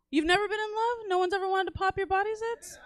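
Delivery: high, soft, incredulous questioning; hushed disbelief. Acted. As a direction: ask it small and high, in mock pity. Feigns disbelief at the audience.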